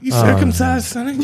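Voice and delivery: granny voice. Acted.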